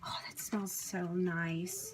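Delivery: slow delighted whisper. Real, not acted. Smelling fresh basil.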